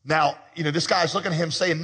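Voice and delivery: ordinary talk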